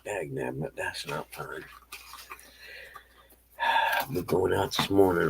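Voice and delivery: ordinary delivery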